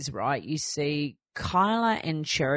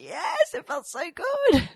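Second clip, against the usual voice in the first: much higher, drawn-out happy gush; gushing delight; 14 semitones higher, slower than usual. Retold or imitated. New mum telling followers how good it felt, high gush. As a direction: gush it like pure relief.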